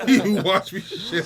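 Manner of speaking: loud, high words through laughter; helpless laughter. Real, not acted. Friend repeating the dog's line, helpless loud laughter. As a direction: crack up while repeating it slowly.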